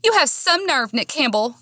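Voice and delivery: high-pitched, indignant accusation; angry confrontation. A narrator's reading. Woman confronting Nick in back lot, indignant. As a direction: throw it at him, pitched high.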